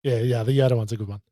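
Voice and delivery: normal talking voice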